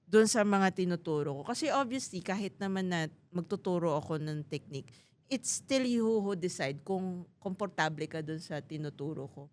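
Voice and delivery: ordinary talk, nothing marked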